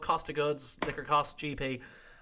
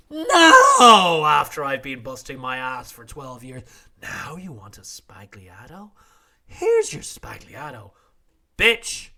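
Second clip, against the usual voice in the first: fed-up bartender rant; 12 dB louder, slower than usual. Retold or imitated. Bartenders snapping at customers who suddenly want Spagliatos, furious mock rant. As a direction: rant like a bartender who's had enough. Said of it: "all the bartenders are like".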